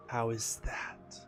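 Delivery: slow whispering serpent voice. Acted. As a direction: breathe it out like an ancient serpent. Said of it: "You like my serpent voice?".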